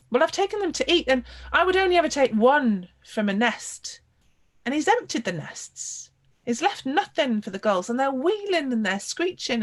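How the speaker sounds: put-on a boy near tears insisting it's unfair; upset boy protesting. Boy upset about emptied nests.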